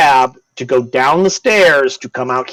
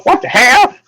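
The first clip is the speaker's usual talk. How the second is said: high startled cry of what the hell; a sudden pitched-up shout of surprise; shocked outburst; 11 semitones higher, 3 dB louder than usual. Acted. Startled stranger behind a door, pitched-up cry. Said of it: "You hear inside go".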